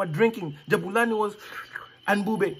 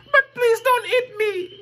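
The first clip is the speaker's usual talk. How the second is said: high, desperate begging; crying plea; 12 semitones higher than usual. Acted. Boy begs the lion not to eat him, high and desperate. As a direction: plead high like a scared child. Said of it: "Jabulani cried".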